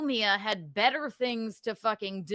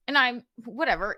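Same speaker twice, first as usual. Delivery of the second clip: usual speaking voice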